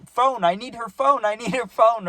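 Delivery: high, insistent mock demand; put-on high, upset pleading; pissed drunk friend. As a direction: imitate a drunk friend desperate for a number. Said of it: "He was pissed".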